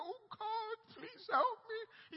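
high, drawn-out imitation of wailing; mock crying plea. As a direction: act out a desperate, tearful cry for help. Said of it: "kneeling down and crying and screaming".

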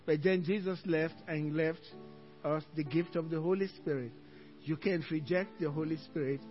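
usual speaking voice

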